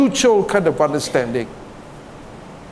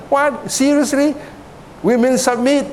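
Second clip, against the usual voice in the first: high-pitched, scoffing disbelief; incredulous questions, pitched way up; mock incredulous; 7 semitones higher than usual. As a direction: act out someone balking at the very idea. Acting scoffing reaction to submission.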